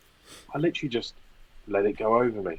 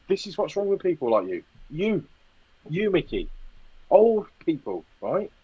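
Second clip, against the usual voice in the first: acted-out grumbling tirade; mock rant; 6 semitones higher than usual. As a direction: rant in a raised pitch without shouting. Acts out rant at shopper.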